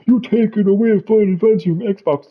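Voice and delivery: high-pitched mocking protest; mock complainer. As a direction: parrot the fans' complaint way up high. Mimicking complaining fans again.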